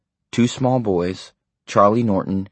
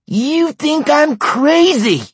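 much higher, louder slow shout; 6 dB louder, much slower than usual. A narrator's reading. Wife shouting back at her husband, outraged. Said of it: "she shouted at him".

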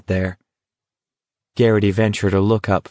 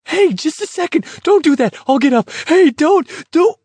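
frantic high-pitched begging, loud bursts; high alarmed pleading; 3 dB louder than usual. Acted. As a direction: plead in panic before the shot. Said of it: "His voice was high and alarmed".

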